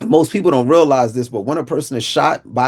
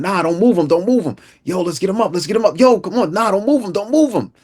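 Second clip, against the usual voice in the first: high-pitched, insistent quarrel, climbing higher; urgent arguing; 8 semitones higher than usual. Retold or imitated. Two men argue over lifting a shot boy, urgent arguing. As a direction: imitate a back-and-forth squabble, high and building.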